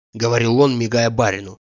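ordinary delivery

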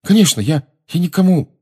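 high, flustered stammer; frightened promise, breaking off; anxious placating. A narrator's reading. Frightened Harry placates Snape.

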